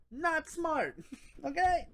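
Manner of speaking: usual speaking voice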